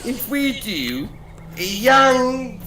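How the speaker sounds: Newcastle accent. Real, not acted. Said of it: "This guy's accent is insane".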